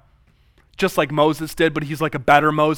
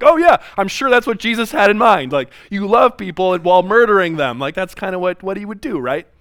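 sarcastic mock voice, higher and louder; 5 semitones higher, 6 dB louder than usual. Critics mocking the host's answer, sarcastic. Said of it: "he gets mocked for that".

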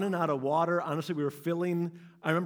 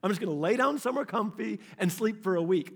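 much higher whiny voice of giving up; high petulant done-with-it whine; exhausted whine; 5 semitones higher than usual. Retold or imitated. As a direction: whine like a worn-out kid begging to quit. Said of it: "I'm like".

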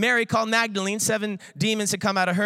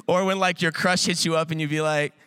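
ordinary talk, nothing marked

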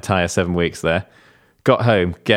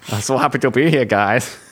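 lightly voiced mocking imitation, a touch higher; a hint of a cheery fake guest; mock impression; 4 semitones higher than usual. Co-host parroting the host's impression back, lightly mocking. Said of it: "Well done".

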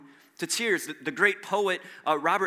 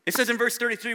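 ordinary talk

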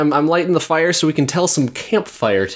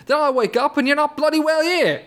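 mock British complaint; 12 semitones higher than usual. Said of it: "British accent has not improved".

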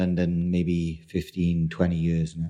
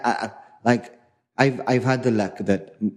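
ordinary talk, nothing marked

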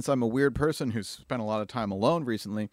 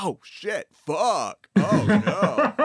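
sudden dismay; 8 semitones higher, 4 dB louder, much slower than usual. Real, not acted. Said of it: "I'm a huge dick".

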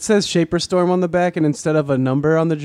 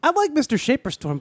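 put-on kid voice; 8 semitones higher than usual. Student talking about teacher.

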